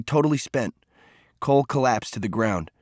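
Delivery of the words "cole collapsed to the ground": plain reading voice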